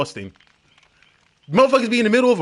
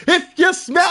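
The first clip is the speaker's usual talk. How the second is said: much higher, drawn-out If You Smell; Rock catchphrase; 10 semitones higher, 5 dB louder than usual. Retold or imitated. Imitating the Rock's entrance call, stretched out. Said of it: "you just hear".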